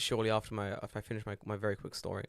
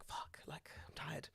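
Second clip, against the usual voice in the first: very soft, whispered grumble; imitating a tired old lady, barely audible; weary old woman; 3 semitones higher, 18 dB quieter, slower than usual. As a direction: mutter it under the breath, worn out. Voicing weary old woman at stairs.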